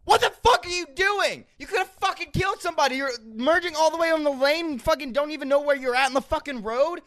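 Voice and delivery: high, angry lecture to a driver; improvised yelling. Pretend. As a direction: scream at the texting driver. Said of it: "A little improv. you scream at me".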